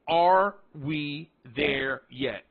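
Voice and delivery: very slow, long gaps between words; drawn-out, every word weighed separately; broken down. Shown as a demonstration. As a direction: spell it out word by word. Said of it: "I break down".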